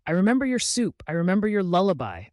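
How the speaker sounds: high, loud, fast desperate cry; screaming. A narrator's reading. Daughter desperately proves herself to her mother, loud high cry. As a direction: scream your proof at them. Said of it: "She screamed".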